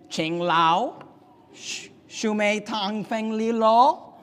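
slow, sing-song fake Chinese syllables; string out invented Chinese sounds; mock Chinese gibberish. Builder answering a puzzled coworker in fake Chinese, hammed-up. Worker answers in made-up language.

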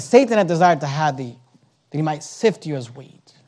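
soft, low pulpit hush; hushed warning. Real, not acted. Preacher repeating the devil's threat to the congregation, hushed warning. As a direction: drop right down, low and grave.

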